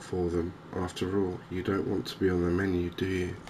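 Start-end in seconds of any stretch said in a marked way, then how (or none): none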